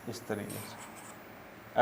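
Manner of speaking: slow, breathy whispered repeat. Shown as a demonstration. Teacher repeats the phrase to students in a slow whisper. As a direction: drop to a whisper, stretching it out slowly.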